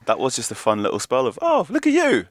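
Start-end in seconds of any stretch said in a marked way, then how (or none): 1.4-2.2 put-on high, loud baby-talk cooing; squeaky patronising praise for a defender; mock doting coo. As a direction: coo at him like a proud parent.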